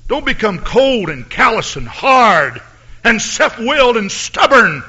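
high, loud, shouted sermon climax, rising with each cry; preaching at full force. Acted. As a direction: rouse the sleeping church with a booming, fiery call.

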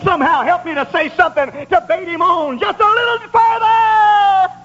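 high sermon cry, building to a long held shout; shouted preaching climax. Real, not acted. Preacher begging help to bait him further, shouted. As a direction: build to a sustained holler.

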